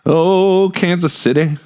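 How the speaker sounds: normal talking voice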